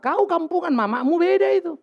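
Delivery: half-joking rebuke, pitched high; mock scolding retort. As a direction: fire it back like a playful rebuke. Pastor retorts to a child.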